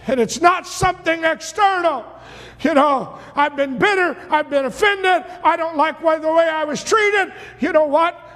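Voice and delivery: preaching at full force. Acted. Preacher urging the church to claim God's promise, full-force preaching. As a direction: preach it out to the congregation, climbing.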